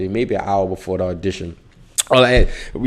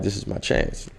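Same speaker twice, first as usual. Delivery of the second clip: whispered to himself; 7 dB quieter than usual. Retold or imitated. Self-talk before audition.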